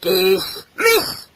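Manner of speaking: growled military command; stern, drawn-out dismissal; father's order. Acted. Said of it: "he shouted".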